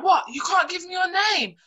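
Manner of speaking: high, affronted mimicry of a rejected man; mock indignant. Rebuffed man challenging her for her name, indignant. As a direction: act put out, voice shooting up.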